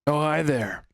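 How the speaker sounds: lightly put-on seductive greeting; sensual voice. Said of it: "Do not do the voice".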